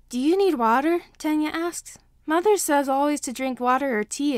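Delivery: put-on a proper little kid quoting his mother; earnest little boy. A narrator's reading. Earnest child offers help.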